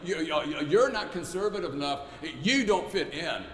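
loud, high, dismissive gatekeeper; a bully turning someone away at the door; sneering bully. Retold or imitated. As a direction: shut them out with a mocking no. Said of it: "Bullies who push people away".

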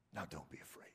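soft, low, tender voicing of God; gentle reassurance. Preacher voices Jesus comforting the frightened, soft and low. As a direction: speak it softly as a comforting voice. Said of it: "to say to you".

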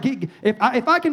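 normal talking voice

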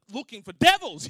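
imitated angry outburst, exploding on devils; frantic anger. Imitating church lady frantically hunting devils, angry. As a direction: blast the last word out loudly. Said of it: "like, really angry".

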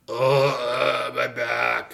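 pained groan about a sore back; groaning complaint. Retold or imitated. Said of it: "I was like".

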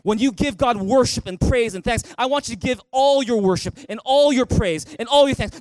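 ordinary talk, nothing marked

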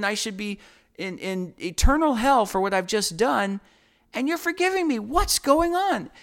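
high-pitched, incredulous protest; angry. Retold or imitated. Forgiven man protesting to the figure, angry and ticked. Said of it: "He's angry".

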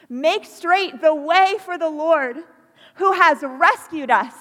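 thundering call to make way for the Lord; loud proclamation. Real, not acted. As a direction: call it out across the whole church. Preacher proclaims call to the congregation.

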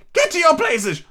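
very high royal yell; thunderous shout. A narrator's reading. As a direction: order everyone about as the queen. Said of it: "in a voice of thunder".

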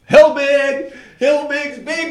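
high, loud cheer. Real, not acted. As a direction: shout it like a chant. Cheering the fundraiser name.

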